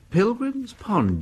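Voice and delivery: high, drawn-out surprised echo; slow, high-pitched astonishment; startled recognition. Acted. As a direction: repeat the name in sudden surprise. Startled to hear the pond named.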